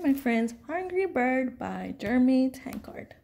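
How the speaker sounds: usual speaking voice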